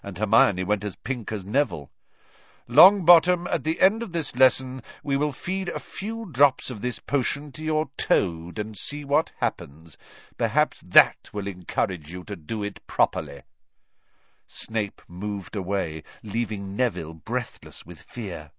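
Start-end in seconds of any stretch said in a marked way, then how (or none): 2.7-13.4 stern teacher. Acted. Teacher threatening a pupil.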